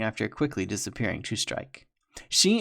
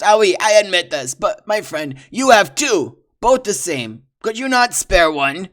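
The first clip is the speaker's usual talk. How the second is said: higher, louder wheedling French voice; desperate bargaining trapper; pleading French trapper; 8 semitones higher, 11 dB louder than usual. Acted. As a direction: plead with a sly French lilt.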